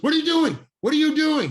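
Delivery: loud, high angry shout. Retold or imitated. Director shouting at the casting agent, loud and high. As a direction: yell at her to stop. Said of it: "He's shouting at her".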